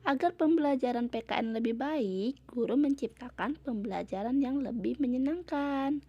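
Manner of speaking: ordinary delivery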